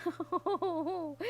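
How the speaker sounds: oh through a laugh. A narrator's reading. Princess at the second-hand knight idea, laughing. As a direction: let the laugh break the word. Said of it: "went off into gales of laughter".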